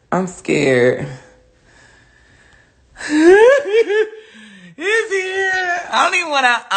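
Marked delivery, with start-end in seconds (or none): 3.0-4.2 high, loud nervous giggle; nervous laugh. Real, not acted. Nervous about opening alligator box. 4.8-6.7 high, whiny nervous dread; scared. Real, not acted. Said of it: "I'm so scared".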